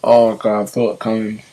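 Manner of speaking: nauseous, fighting the urge to gag; queasy, about to throw up. Real, not acted. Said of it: "I'm gonna throw up".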